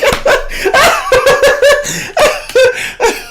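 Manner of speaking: high, loud helpless laughter; loud laughing fit. Real, not acted. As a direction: howl with laughter. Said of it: "it's back".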